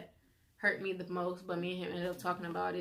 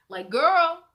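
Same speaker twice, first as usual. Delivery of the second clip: dramatic dread; 9 semitones higher, 11 dB louder than usual. Retold or imitated. Expecting grandma's scolding.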